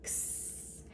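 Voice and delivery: X breathed out as a soft ks hiss; a soft ks hiss for the letter X; whispered sound. Shown as a demonstration. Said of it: "that X sound at the very end".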